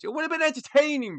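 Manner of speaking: high, loud hyped-up; excited. Real, not acted. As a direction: burst with excitement. Excited hype.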